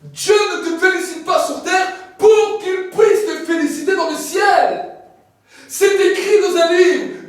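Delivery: loud, high preaching cry; preaching at full force. Acted. Preacher shouting to the congregation about heaven's reward. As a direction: lift the congregation with a booming preach.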